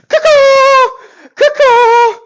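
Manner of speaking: very high, loud cuckoo bird cry; cuckoo call. Real, not acted. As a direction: shriek like a cuckoo clock. Celebrating with silly bird calls.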